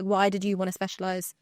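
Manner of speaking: normal talking voice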